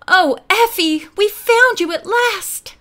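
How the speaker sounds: high, loud relieved cry; excited exclamation. A narrator's reading. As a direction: call out in joyful relief. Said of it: "Nancy exclaimed".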